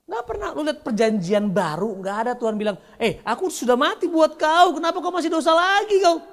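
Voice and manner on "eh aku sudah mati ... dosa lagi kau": mock scolding. Mock scolding God figure.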